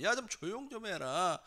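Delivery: retold scolding. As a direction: imitate annoyed bystanders telling someone off. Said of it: "아마 그러면서 꾸짖었을 거예요".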